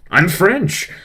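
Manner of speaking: high, loud mock French outburst; terrible French accent. French tutor blurting out of the blue, terrible French accent. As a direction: burst out in a bad French accent. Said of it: "do a terrible French accent, uh, or impression of him".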